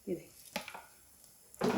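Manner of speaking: breathy near-silent "look"; slow whispered look-here; whispered aside. Real, not acted. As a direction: drop to a whisper, slowly. Aside while spreading egg.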